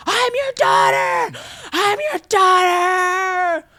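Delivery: high, slow, shouted daughter's plea; mock-cry it out like a movie heroine reunited; hammed-up tearful cry. Retelling the film's climax.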